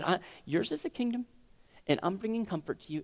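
softly spoken comfort; soothing answer. Acted. God answering a cry for help, soft soothing voice. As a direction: drop to a gentle hush.

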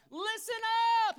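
high, loud rallying yell; urgent shout. Acted. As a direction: shout for everyone's attention. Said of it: "He's saying, like".

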